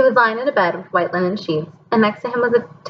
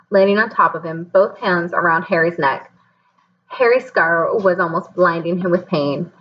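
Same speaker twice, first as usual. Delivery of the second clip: plain reading voice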